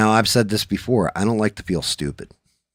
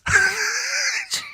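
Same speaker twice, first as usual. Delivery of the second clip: shrieking laugh. Real, not acted. Laughing hard.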